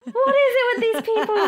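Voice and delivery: high, loud mock exasperation through laughter; laughing disbelief. Recalling the positive pregnancy test picture, laughing in disbelief.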